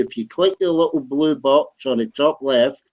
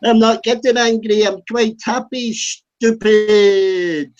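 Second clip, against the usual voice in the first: raised pitch, insistently protesting calm; indignant denial; 6 semitones higher, slower than usual. Real, not acted. Answering someone who asks why he's so angry, indignant. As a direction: deny the anger in a high, drawn-out voice. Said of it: "Why are you so angry?".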